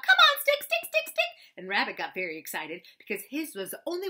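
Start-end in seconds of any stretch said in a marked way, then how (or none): 0.0-1.5 high, loud cheering at the stick; calling out. Acted. Roo wiggles up and down, cheering his stick with high shouts. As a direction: squeal it on like a tiny fan. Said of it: "calling out".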